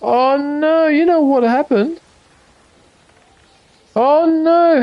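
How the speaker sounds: high, drawn-out "oh no" of dismay. Real, not acted. Streamer realizing she forgot a setup step, real dismay. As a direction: wail it lightly as the mistake dawns.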